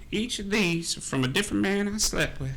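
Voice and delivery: put-on an old camp woman bragging about her lovers; old woman's voice. Old woman bragging to the party, with a laugh. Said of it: "She's like".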